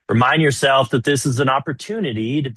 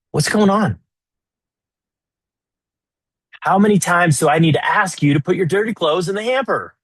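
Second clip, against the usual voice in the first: much higher and louder, fed up; exasperated wife; 7 semitones higher, 4 dB louder than usual. Retold or imitated. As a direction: nag him about the hamper. Wife voiced complaining.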